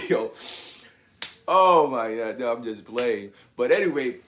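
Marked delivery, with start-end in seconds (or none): none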